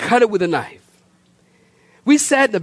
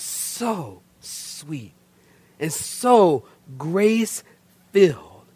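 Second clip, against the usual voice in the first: slow, much softer reverent words; reverent hush; 5 semitones lower, 5 dB quieter, much slower than usual. Real, not acted. Pastor telling the congregation of a sweet church, slow and hushed. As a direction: linger on it with quiet awe. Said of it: "slow reverent testimony".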